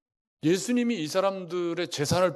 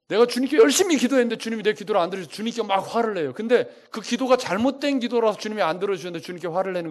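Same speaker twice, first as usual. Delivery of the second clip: preaching at full force; 5 semitones higher, 5 dB louder, faster than usual. Acted. Acts out angry prayer, preaching.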